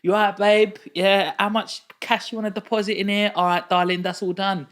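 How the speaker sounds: loud, high-pitched, over-familiar counter patter; brash East London cashier. Retold or imitated. Young bank teller serving a customer, loud East London familiarity. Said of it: "you can't talk like that".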